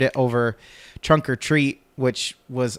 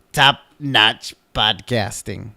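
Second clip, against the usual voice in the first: sarcastic; 3 semitones lower, slower than usual. Real, not acted. Call keeps failing.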